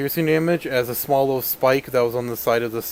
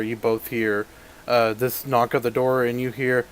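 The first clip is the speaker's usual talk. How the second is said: ordinary talk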